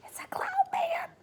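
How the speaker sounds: high, breathless unvoiced whisper; frightened whisper. A narrator's reading. Miss Spider spotting cloud men, frightened whisper. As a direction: gasp it out in fear. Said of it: "cried Miss Spider".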